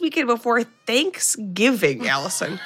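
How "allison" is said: ordinary talk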